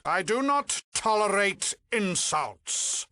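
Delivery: high, affronted rebuke; offended and imperious; indignant villain. Acted. Villain reacts to an insult.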